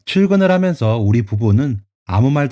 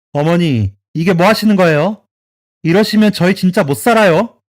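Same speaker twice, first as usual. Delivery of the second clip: higher, louder outraged shout; wife shouting at her mother-in-law, fed up; retold yell; 6 semitones higher, 4 dB louder than usual. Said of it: "하고 소리를 질렀죠".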